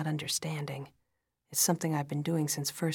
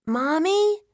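put-on high, loud rising child's call; small child; 12 semitones higher, 10 dB louder than usual. A narrator's reading. Daughter interrupting mother's phone call, small child's voice. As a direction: call out as an alarmed little child.

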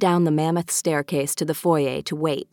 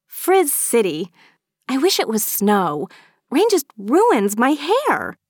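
high-pitched, drawn-out grumble; moaning complaint; 7 semitones higher, 3 dB louder, slower than usual. A narrator's reading. Maeve grumbles about rain on her hair, moaning. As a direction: bemoan it, leaning slowly on the words. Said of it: "Maeve moaned".

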